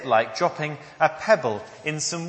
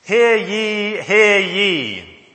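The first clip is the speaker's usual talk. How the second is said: loud town-crier call through a megaphone; toy megaphone; 4 semitones higher, 9 dB louder, much slower than usual. Shown as a demonstration. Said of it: "give you an announcement through this megaphone".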